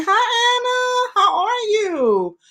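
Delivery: high, bright hello that jumps up; excited greeting. Real, not acted. Host greeting a viewer who joined, excited high hello.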